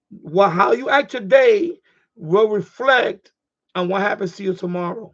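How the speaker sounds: very slow, each word weighted; slow emphatic lesson. Real, not acted. To listeners on the ham radio net, slow and solemn. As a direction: spell out a life lesson slowly. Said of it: "Record that little thing that I just told you".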